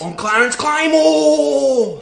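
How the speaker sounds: loud, slow and proud; booming and slow, chest puffed out; say it with your chest. Pretend. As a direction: announce your name with full chest. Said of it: "Say it with your fucking chest".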